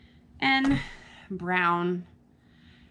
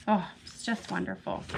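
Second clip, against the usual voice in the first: ordinary delivery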